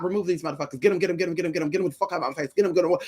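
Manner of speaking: soft fast muttered plea; hushed rushed prayer. Retold or imitated. Praying to God to remove people, hushed. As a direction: rattle off the prayer under breath. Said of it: "I was praying".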